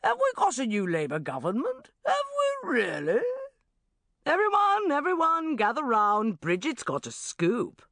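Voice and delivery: mock amazement, then summon the room; Richard Finch. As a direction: boss mocking Bridget in front of staff. Richard mocking Bridget to room.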